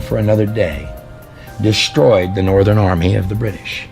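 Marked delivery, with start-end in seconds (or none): none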